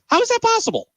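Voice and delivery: exasperated disbelief. Real, not acted. Host's disbelief at a scoreless team.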